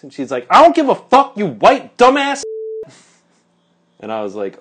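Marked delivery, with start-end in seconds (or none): 0.5-2.4 very high, loud mock shriek; imitated angry screaming. Woman at the next pump cursing the narrator, screaming. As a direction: scream it back in full imitation. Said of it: "like, screaming".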